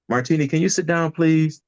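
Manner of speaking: a mildly exasperated teacher asking him to sit. Retold or imitated. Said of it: "And she's like".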